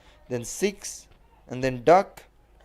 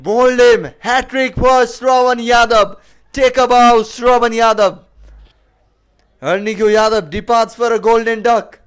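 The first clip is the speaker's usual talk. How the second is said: excited; 4 semitones higher, 9 dB louder than usual. Real, not acted. Replay of the hat-trick wicket.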